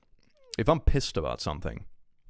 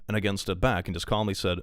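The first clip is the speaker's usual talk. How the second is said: ordinary delivery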